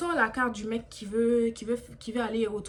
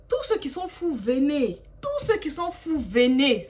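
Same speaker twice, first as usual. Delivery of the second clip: sarcastic calling out; 4 semitones higher, 5 dB louder, slower than usual. Retold or imitated. Sarcastic call to all the crazies.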